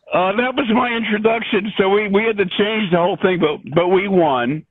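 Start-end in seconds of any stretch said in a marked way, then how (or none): none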